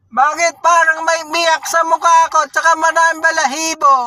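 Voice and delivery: ngongo voice. Retold or imitated. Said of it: "Sabi niya".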